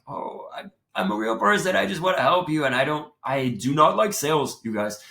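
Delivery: high, pleading cry of protest. Retold or imitated. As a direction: beg them not to leave. Pleading to unsubscribers.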